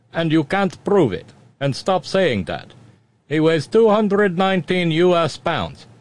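put-on cheesy Russian accent. Host riffing as a Russian spokesman, cheesy Russian accent. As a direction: insist like a Kremlin propagandist in a thick Russian accent. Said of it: "more cheesy Russian accent".

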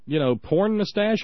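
normal talking voice

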